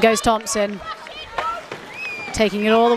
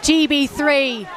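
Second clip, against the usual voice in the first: excited goal shout; 5 semitones higher than usual. Real, not acted. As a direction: roar the scorer's nickname. Goal scored, commentator erupts.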